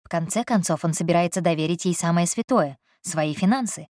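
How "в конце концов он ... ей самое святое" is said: plain reading voice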